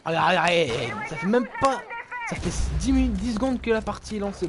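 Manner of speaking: loud exasperated urging; frustrated. Real, not acted. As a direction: push them on, fed up. Frustrated at teammates.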